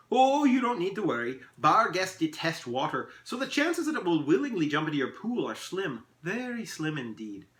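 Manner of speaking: high, breezy elf voice; lilting elf, easing as he goes; reassuring elf. Acted. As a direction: wave the worry away grandly.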